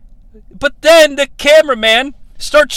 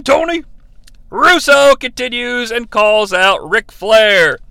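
usual speaking voice

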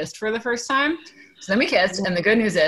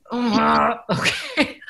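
stunned laugh; 4 semitones higher, much slower than usual. Retold or imitated. Boss asks her to lead call.

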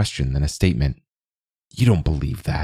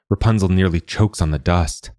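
usual speaking voice

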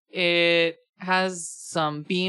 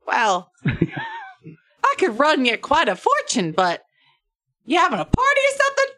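high, hammed-up accented voice; bad-accent barkeep; 11 semitones higher, 5 dB louder than usual. Barkeep reacting to a huge pizza order, hammed-up accent. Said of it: "only do one version of this accent".

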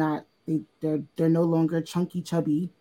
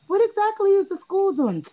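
prying parent; 4 dB louder than usual. Retold or imitated. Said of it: "he catches on when I'm like".

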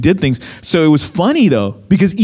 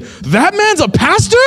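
slow, high-pitched incredulous question; shocked disbelief; 11 semitones higher than usual. Retold or imitated. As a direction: imitate a guard who can't believe it. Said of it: "he was in shock".

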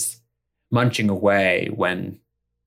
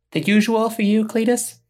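bright, much higher waitress asking sweetly; 4 dB louder than usual. Acted. Waitress greeting her regular, high and sweet. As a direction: chirp it up high like a diner waitress. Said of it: "She says".

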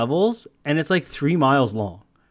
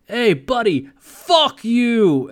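loud, high mock yell at another driver; shouted from a truck window; New York trucker; 9 semitones higher, 4 dB louder, much slower than usual. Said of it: "He goes".

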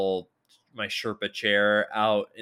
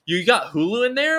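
put-on nosy kid; 13 semitones higher, 7 dB louder than usual. Acting out the kid at the door.

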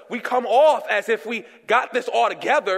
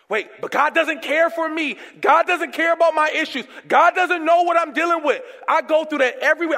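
doubting believer, higher; 6 semitones higher than usual. Retold or imitated. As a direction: plead high-pitched that God doesn't care.

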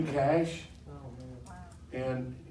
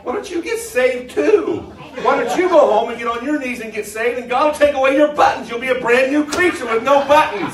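loud, high preaching with laughs; fired-up preaching; 9 semitones higher, 12 dB louder than usual. Acted. Preacher to congregation about buttons, loud and laughing. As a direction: shout it at the congregation with a grin.

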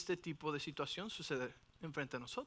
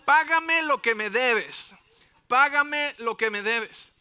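loud, slow, angry demand, pitched higher; demanding shout; 5 semitones higher, 12 dB louder, slower than usual. Acted. Forgiven servant chokes his fellow servant for money, angry shout. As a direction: bark it like a creditor choking his debtor. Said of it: "le ahogaba diciendo".

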